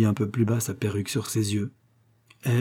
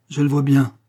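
raised, louder note of reproach; reproachful; 4 semitones higher, 6 dB louder than usual. A narrator's reading. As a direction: say it as a wounded accusation. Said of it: "avec un accent de reproche". (ton de reproche, voix plus haute)

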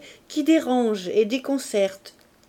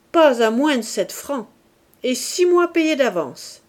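louder, higher shout from the back room; calling out; 4 semitones higher, 5 dB louder than usual. Acted. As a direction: yell the price across the inn. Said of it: "une voix d'homme cria du fond de la gargote". (crier plus fort et plus haut depuis le fond de la gargote)

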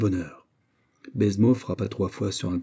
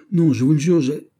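higher, louder insistent denial; raised voice, protesting in a fluster; 6 semitones higher, 5 dB louder than usual. A narrator's reading. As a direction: blurt out a sworn denial, cut short. Flustered governor protests, gets cut off.

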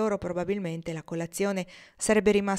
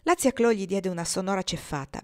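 usual speaking voice

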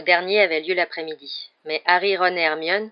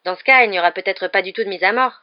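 determined, louder; decided tone; 3 semitones higher, 8 dB louder than usual. A narrator's reading. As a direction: state it with firm resolve. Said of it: "dit Ron d'un ton décidé".

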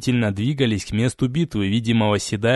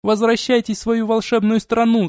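desperate shouting; 10 semitones higher than usual. Acted. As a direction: shout while held fast. Princess screams while restrained.

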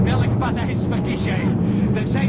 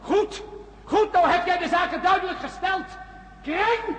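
angry retort; 8 semitones higher than usual. Acted. Peter snaps at Erika.

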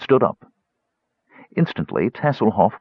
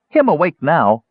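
high, smug little gully dwarf voice; complacent gully dwarf; 9 semitones higher, 4 dB louder than usual. A narrator's reading. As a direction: announce it, pleased with herself. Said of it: "Bupu announced complacently".

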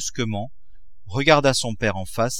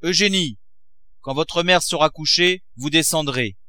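raised, higher commanding call; 7 semitones higher than usual. A narrator's reading. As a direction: call upstairs sternly. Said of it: "et cria".

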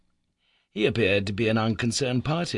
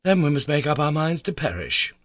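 louder, higher despairing cry; helpless cry; 6 semitones higher, 5 dB louder than usual. A narrator's reading. Harry giving up to his uncle, helpless. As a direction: cry it out, resigned. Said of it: "I cried with a helpless sigh".

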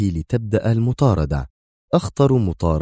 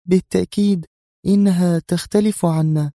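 ordinary delivery

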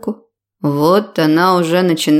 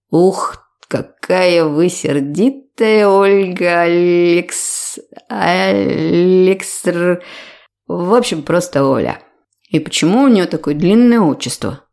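put-on drunk slur. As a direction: stumble over the long name. Drunk man teasing nurse.

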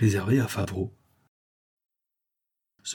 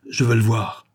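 imperious demand; 2 semitones higher, 4 dB louder than usual. A narrator's reading. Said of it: "exigeait Favreau d'un accent impérieux, farouche".

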